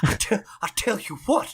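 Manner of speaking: hammed-up Southern voice; southern accent. Co-hosts riffing on a steamy scene, mock Southern accent. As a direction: play it as a Southerner. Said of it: "a southern accent going".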